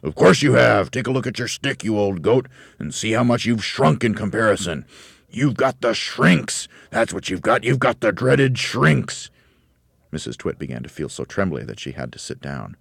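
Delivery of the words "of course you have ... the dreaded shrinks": raised, emphatic husband voice; loud taunting. A narrator's reading. Husband convincing his wife she has shrunk, loud gloating taunt. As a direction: pile it on with relish.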